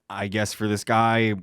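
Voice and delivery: ordinary delivery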